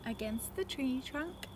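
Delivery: plain reading voice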